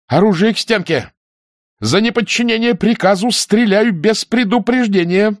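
high, forceful ultimatum; armed command. A narrator's reading. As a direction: order them at gunpoint, pitched high. Nina at gunpoint orders soldiers. (грозный приказ)

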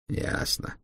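low-volume grudging croak; grudging creaky assent; creaky, displeased. A narrator's reading. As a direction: give in sourly, almost voiceless. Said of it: "Голос биохимика был скрипучим, недовольным".

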